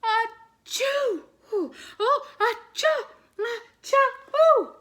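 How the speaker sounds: big high loud acted sneezes; three huge sneezes, ending in a squeal; hearty sneezes. A narrator's reading. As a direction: rag man sneezing hard enough to blow himself away. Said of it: "three hearty sneezes".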